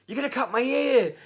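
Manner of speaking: high, loud mock panic yell; panicked child. Child to hairdresser cutting his hair, mock panicked yell. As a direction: imitate a kid shrieking in alarm. Imitates son panicking in haircut.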